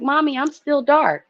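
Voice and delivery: put-on high little girl voice. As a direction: say it as a proud small daughter. Daughter voiced after school.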